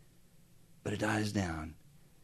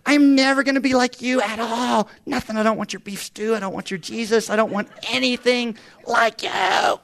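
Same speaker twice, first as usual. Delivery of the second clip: higher, louder defiant shout; mock teen tantrum; 9 semitones higher, 5 dB louder than usual. Daughter yelling at father, mock defiant shout. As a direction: play the furious teenager rejecting dad. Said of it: "she acted like".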